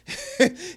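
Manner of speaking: brief high laugh. Real, not acted. Reviewer amused by hero's reply, laughing. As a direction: break off for a quick high chuckle. Chuckles at retold line.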